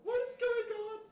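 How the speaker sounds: very high, laughter in the voice; baffled, nearly crying with laughter; laughing disbelief. Real, not acted. Laughing disbelief at game text.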